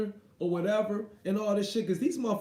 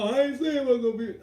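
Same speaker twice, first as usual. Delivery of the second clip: mocking commentator; 5 semitones higher, 4 dB louder, faster than usual. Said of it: "Porter saying some".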